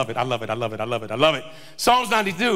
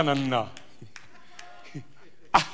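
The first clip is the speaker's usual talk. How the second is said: usual speaking voice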